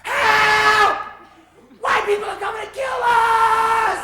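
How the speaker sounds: high, loud panicked yell; panicked shout. Acted. Radio announcer yelling warning to listeners, hammed-up panic. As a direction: scream for help in mock terror.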